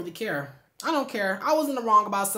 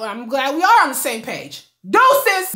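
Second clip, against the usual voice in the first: sassy sign-off; 5 semitones higher, 10 dB louder, slower than usual. Retold or imitated. Sassy parting shot in breakup text.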